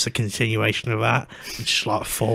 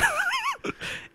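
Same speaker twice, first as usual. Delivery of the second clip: high squeaky laugh; 8 dB quieter than usual. Real, not acted. Laugh after toilet story.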